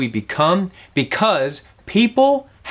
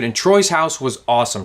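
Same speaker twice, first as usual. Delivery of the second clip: ordinary talk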